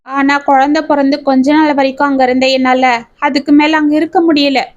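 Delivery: ordinary delivery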